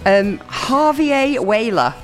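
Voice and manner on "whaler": ordinary talk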